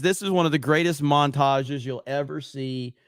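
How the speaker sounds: ordinary talk, nothing marked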